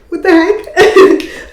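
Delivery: put-on amazed at finding the old Bible; stunned laugh. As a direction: blurt it out slowly through a laugh. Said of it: "I was like".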